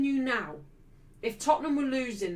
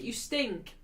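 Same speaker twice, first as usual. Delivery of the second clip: ordinary talk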